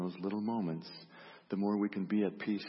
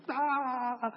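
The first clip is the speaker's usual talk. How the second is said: short high mock scream of alarm; a hammed-up gasp of panic; mock panicked yelp. Panicked reaction in retold story.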